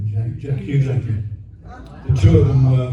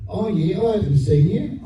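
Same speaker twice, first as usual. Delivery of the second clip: imitated Aussie accent, pitched higher; higher mock Aussie voice; 7 semitones higher than usual. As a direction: put on a woman's Aussie accent, lifted in pitch. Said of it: "you've got a bit of an Aussie accent".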